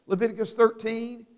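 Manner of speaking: ordinary talk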